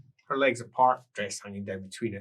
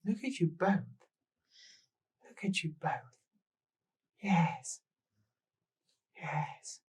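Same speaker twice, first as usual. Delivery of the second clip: cooing lady; 6 semitones higher, slower than usual. Acted. Woman admiring the pair, a high fading coo. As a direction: coo over them.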